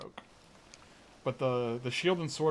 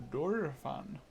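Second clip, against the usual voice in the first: Dorephan as "Dorfan", a touch of thick English accent, falling; 3 semitones higher, slower than usual. Shown as a demonstration. Streamer jokes on the king's name in English accent. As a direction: say Dorephan like a posh English dolphin. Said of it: "sounds like a really thick English accent to say Dorephan".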